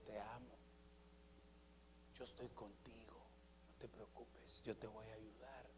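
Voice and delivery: very low, near-whispered reassurance; tender, loving voice. Acted. God drawing near to comfort you, tender whisper. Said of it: "el amor con que le habla".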